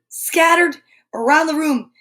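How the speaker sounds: plain reading voice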